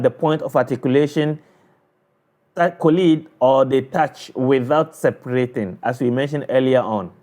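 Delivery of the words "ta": ordinary talk, nothing marked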